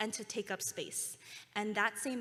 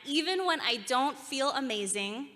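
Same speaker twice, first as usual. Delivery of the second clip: loud confident affirmation; 3 semitones higher, 8 dB louder than usual. Shown as a demonstration. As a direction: finish the chant with full conviction. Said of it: "your loudest, most confident voice".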